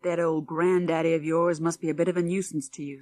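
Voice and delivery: plain reading voice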